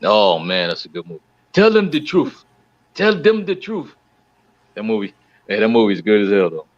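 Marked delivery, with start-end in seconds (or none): 1.5-2.4 high, loud call to tell the truth; emphatic. Real, not acted. Friend urging others about the movie, high and loud. 2.9-3.9 emphatic. Real, not acted. Repeated emphatic call.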